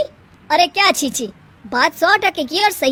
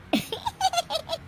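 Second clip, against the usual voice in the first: mocking laugh; 11 dB quieter than usual. Mother-in-law mocks the lisp.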